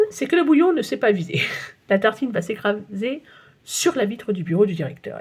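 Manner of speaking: ordinary delivery, nothing marked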